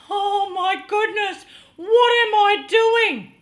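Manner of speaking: loud, high cry of alarm; acted-out panic, voice pitched right up; panicked. Relives panic looking down from platform.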